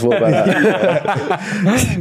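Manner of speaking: laughing. Real, not acted. Joking, dissolving into laughter.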